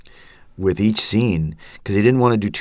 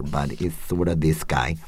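higher Guatemalan voice; 5 dB quieter than usual. Shown as a demonstration. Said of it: "it's a little higher".

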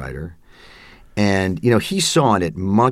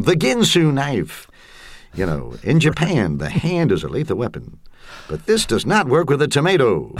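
TV pitchman; 8 semitones higher than usual. Retold or imitated. Imitating a seventies knife commercial as a hammed-up pitchman. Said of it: "a lot of pitchmen".

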